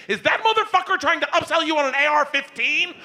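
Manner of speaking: high, shrieky indignant voice; outraged wife. Retold or imitated. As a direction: burst in shrieking with suspicion. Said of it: "comes marching in the kitchen".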